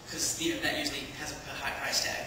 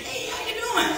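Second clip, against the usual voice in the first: eager greeting. Retold or imitated. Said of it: "'Cause I thought that was something that you should ask".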